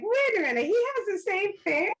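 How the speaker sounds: high-pitched mock double take; retold surprise. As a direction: squeal it in playful disbelief. Retells own surprised reaction.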